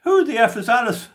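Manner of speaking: high, loud chanted heckle; disco crowd shout. Retold or imitated. Man imitating disco-goers answering the song, shouted chant. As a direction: shout it like a crowd joining the chorus. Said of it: "at the discos they shout".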